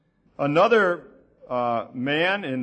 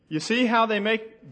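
ordinary talk

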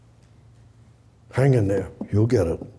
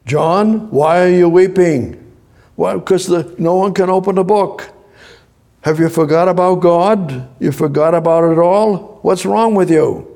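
raised, higher-pitched rebuke; exasperated scolding; 6 semitones higher, 5 dB louder than usual. Acted. Preacher rebuking John for weeping as if God were forgotten, exasperated scolding. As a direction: challenge him in a loud, high voice.

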